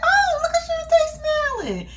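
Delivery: high-pitched gushing squeal; mock gushing. Vlogger retelling her reaction to Shantae's smile, high mock gushing.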